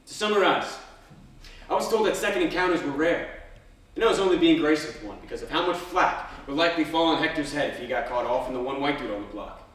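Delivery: usual speaking voice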